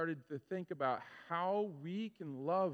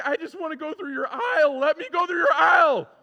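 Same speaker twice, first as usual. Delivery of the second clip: shouting, much higher and louder, exasperated; impatient outburst; 13 semitones higher, 17 dB louder than usual. Retold or imitated. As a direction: blow up at the staff blocking the aisle. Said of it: "And I'm like".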